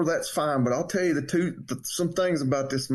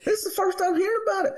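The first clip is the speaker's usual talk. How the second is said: much higher and fast, mock outrage; indignant surprise; much faster than usual. Real, not acted. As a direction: rush it out, high and offended. Outraged at missing the deal.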